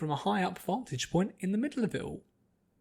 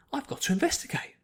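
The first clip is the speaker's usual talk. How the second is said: eager Pinky; 10 semitones higher, faster than usual. Acted.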